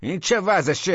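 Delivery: high, loud indignant shout. A narrator's reading. Tenant emboldened by a mild intruder, shouting. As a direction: shout it with newfound nerve. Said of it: "داد زدم".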